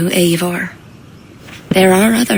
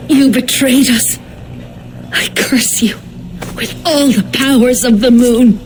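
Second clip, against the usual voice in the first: furious curse; 5 semitones higher than usual. Acted. Dying druid curses the traitor who betrayed her, furious.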